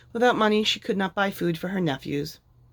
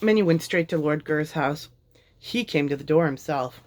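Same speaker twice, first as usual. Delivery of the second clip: ordinary delivery, nothing marked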